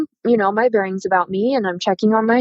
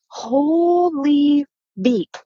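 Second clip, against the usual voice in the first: drawn-out, higher-pitched amazement; stunned disbelief; 5 semitones higher, much slower than usual. Retold or imitated. Driver retelling her shock at the crash, stunned. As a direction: stretch it out slowly, dumbfounded.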